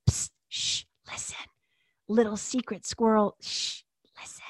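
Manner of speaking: conspiratorial whisper close to the mic. Pretend. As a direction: lean in and whisper a secret. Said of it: "I feel I'm whispering".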